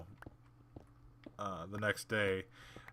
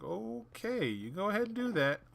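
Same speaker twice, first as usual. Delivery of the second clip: sarcastic okay; 12 semitones higher than usual. Retold or imitated. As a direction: humour her with fake cheer. Sarcastic reply to girl.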